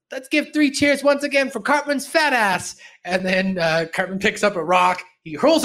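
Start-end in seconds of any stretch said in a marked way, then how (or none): 0.1-2.7 high, loud mock cheer-leading; trying to be cool. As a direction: call for cheers, eager to fit in. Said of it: "trying to be cool".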